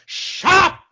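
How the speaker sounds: loud, high mock shout of shut up; imitating a wrestler silencing fans; mock yell. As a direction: snap at a chattering crowd. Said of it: "he'll be just like".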